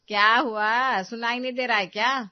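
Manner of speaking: loud, irritated questioning; impatient demand. Acted. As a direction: raise your voice at the unresponsive daughter-in-law. Mother-in-law demands an answer.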